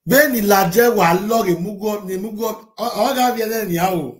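loud, high, fast Yoruba rant; fast heated rant. Real, not acted. Vlogger ranting at critic in Yoruba, fast loud rant. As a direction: fire it off at them.

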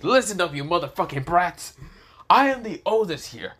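loud, high outburst of authority; acted rage, every phrase pushed high; furious scolding. Old dragon berates two disrespectful brats, in loud indignant fury.

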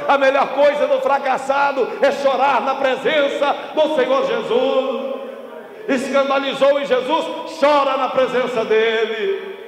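high, projected pulpit delivery; impassioned preaching. Real, not acted. Preacher warns congregation about failure, impassioned preaching. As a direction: preach it out with full conviction.

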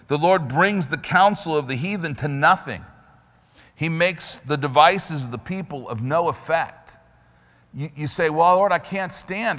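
loud, high preacher's cry, racing ahead; preaching. Acted. Preacher urging the congregation to stand, loud and fast. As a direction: drive the scripture home hard.

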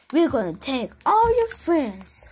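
ordinary delivery

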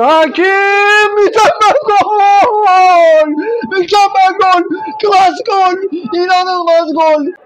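high, loud goal scream; screaming football commentator. Real, not acted. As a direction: shout it out in wild joy.

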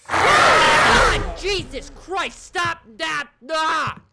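high startled scream into slow, loud cursing; startled angry shout. Real, not acted. Player scolding whoever just scared them, startled shouting. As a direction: yell it in shock, dragging the words.

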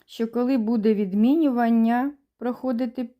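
ordinary talk

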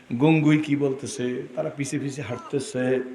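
ordinary delivery

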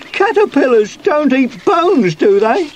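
high, loud anxious question; worried. A narrator's reading. Sweep asks Sue about his buried bones, worried. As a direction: fret aloud in a high puppet-like voice. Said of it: "looking worried".

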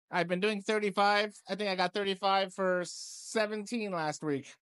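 normal talking voice

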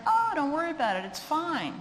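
high, airy don't-worry tone; mock carefree friend; breezy dismissal. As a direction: brush off the worry lightly. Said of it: "they're like".

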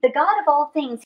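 usual speaking voice

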